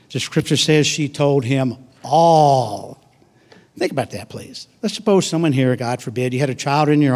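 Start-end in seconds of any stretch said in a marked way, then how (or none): none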